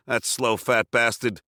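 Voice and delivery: plain reading voice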